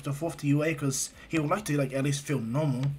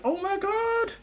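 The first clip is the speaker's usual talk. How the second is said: very high falsetto squealing; high-pitched gossipy disbelief; shocked squeal; 3 dB louder than usual. Retold or imitated. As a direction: squeal like thrilled classmates spotting new couples. Mina and classmates gasp at new couples.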